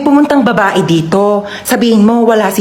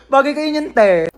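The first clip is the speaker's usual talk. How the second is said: ordinary talk, nothing marked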